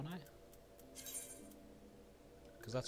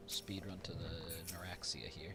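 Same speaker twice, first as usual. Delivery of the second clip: slow hushed whisper; 3 semitones lower, 7 dB quieter, slower than usual. Real, not acted. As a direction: whisper it like a secret. Whispered aside while moving.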